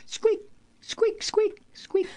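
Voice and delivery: very high tiny mouse squeaks. Pretend. Host mimicking the timid Ukrainian president, high squeaks. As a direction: squeak like a frightened little mouse.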